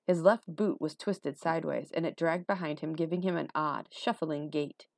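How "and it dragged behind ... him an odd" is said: usual speaking voice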